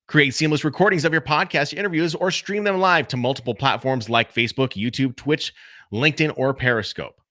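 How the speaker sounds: podcast ad read, re-recorded; ad copy read. Shown as a demonstration. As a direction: sell the copy like a promo spot. Reads the ad copy in full.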